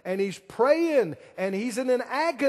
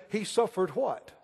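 normal talking voice

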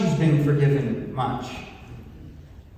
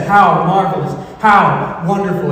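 joyful exclamation; 3 semitones higher, 8 dB louder than usual. Acted. Woman's praise, preached aloud.

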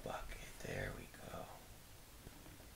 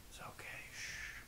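whispered reassurance; 12 dB quieter than usual. Real, not acted. Calming sick partner.

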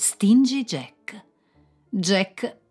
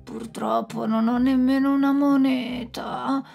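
bait the devil with false innocence; crafty Jack; 5 semitones higher than usual. Acted. Jack chiede con astuzia. (Jack astuto, voce più alta e lenta)

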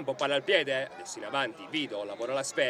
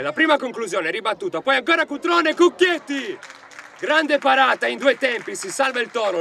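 rising, louder burst for the save; excited save call; 8 semitones higher, 8 dB louder than usual. Real, not acted. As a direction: lift the voice as the keeper saves. Goalkeeper save in live commentary. (telecronaca concitata della parata)

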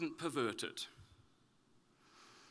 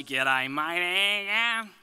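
louder, broad Australian greeting; broad Australian accent; 3 semitones higher, 8 dB louder than usual. Shown as a demonstration. Australian expat showing his old accent, broad Australian greeting. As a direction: lay on the Aussie accent, loud and cheery. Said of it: "I am from Australia originally".

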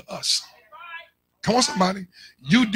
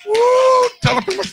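high, loud whoop then a high call; excited whoop; 14 semitones higher, 5 dB louder than usual. Real, not acted. Pastor whooping before telling people to repeat, high loud excitement. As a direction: burst out with a joyful whoop. Preacher erupting mid-teaching.

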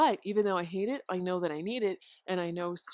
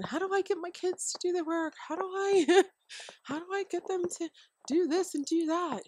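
mock whiny parent; 11 semitones higher, 4 dB louder than usual.

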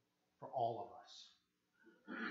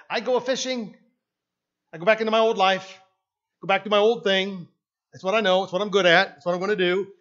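louder and higher, stubborn; defiant declaration; 6 semitones higher, 15 dB louder, faster than usual. Pretend. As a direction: announce it with dogged resolve. Peter declares returning to old life.

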